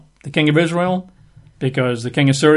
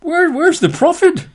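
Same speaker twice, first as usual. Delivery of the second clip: stammering, very high outrage; indignant surprise; slower than usual. Acted. Naaman finding only a messenger, stammering in high indignation. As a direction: sputter it out, much higher, affronted. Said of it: "Naaman's not gonna be impressed".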